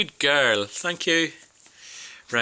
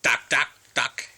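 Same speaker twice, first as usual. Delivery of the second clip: duck quack; 3 semitones lower than usual. Pretend. Parent imitates duck for toddler.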